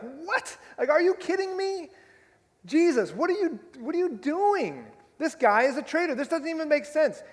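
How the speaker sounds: high, loud, incredulous protest; crowd's outrage. Retold or imitated. Preacher acting the crowd scolding Jesus, high outraged protest. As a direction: play the outraged townsfolk, voice jumping up. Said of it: "They're like".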